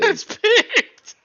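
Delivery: high, loud, laughing disbelief. Real, not acted. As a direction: laugh it out in shock. Laughing at an interception.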